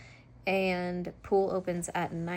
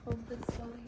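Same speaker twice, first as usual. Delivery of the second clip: much higher, softer sing-song pet voice; 9 semitones higher, 7 dB quieter, faster than usual. Real, not acted. Owner asking her cat where the treats are, in high cooing baby talk.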